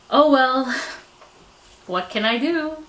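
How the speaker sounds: ordinary talk, nothing marked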